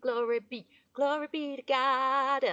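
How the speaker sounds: preaching praise. Real, not acted. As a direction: testify like at a revival.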